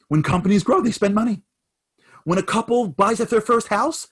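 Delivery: rally him with conviction; impassioned pep talk. Retold or imitated. Warren Buffett firing up the despairing CEO, impassioned. Buffett passionately reassuring son.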